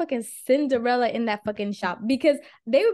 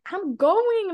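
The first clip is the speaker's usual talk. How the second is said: pitched up, annoyed; exasperated reply; 8 semitones higher than usual. Retold or imitated. Teen snapping back at her mom, mock exasperated. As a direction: brush off the nagging. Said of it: "and I'm like".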